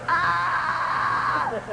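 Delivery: high half-laughing yell while falling; high falling scream. Retold or imitated. Preacher acting out his fall from heaven, high yelling scream. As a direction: scream as if dropping through the sky. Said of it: "I was going".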